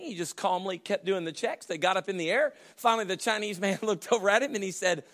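ordinary talk, nothing marked

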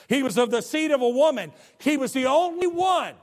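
rising from firm to high and loud; building sermon. Acted. Preacher builds his case to the church, rising loud. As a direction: climb to the peak of the point.

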